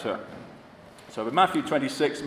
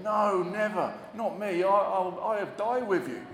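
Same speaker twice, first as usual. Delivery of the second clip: high, earnest protest as Peter; 6 semitones higher than usual. Retold or imitated. Peter vowing loyalty to Jesus, high and earnest. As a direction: insist you'd never abandon him.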